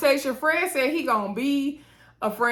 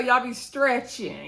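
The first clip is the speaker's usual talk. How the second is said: drawn out, teasing; slow teasing; 3 semitones higher, slower than usual. Real, not acted. Vlogger teasing cast members for exaggerating, slow and drawn out. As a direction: stretch it slowly like a tease.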